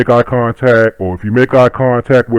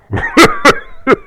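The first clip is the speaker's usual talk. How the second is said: high laugh. Real, not acted.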